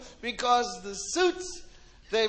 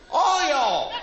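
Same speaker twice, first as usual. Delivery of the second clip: put-on loud Southern accent; 2 semitones higher, 5 dB louder than usual. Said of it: "Mississippi dude".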